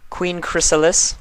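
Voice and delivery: high, loud royal roll call; Luna's regal call. Acted. Luna calling the first name on her list. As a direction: call the name like a princess taking attendance.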